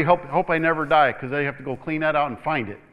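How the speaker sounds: normal talking voice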